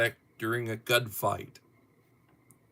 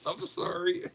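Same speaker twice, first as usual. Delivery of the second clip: real tearful laughter, much higher; laughing to tears. Real, not acted. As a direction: laugh until your eyes run. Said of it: "I'm starting to, uh, tear up".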